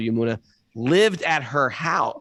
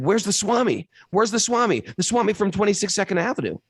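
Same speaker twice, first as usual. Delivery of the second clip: much higher, pressing repeat; urgent insistence; 9 semitones higher than usual. Retold or imitated. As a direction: demand it again and again, urgently.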